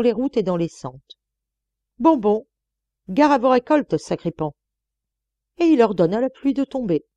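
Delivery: a country dean sermonizing schoolchildren, rising to scolding; old dean lecturing pupils on sin; preaching priest. Acted. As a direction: thunder at the children like a stern priest.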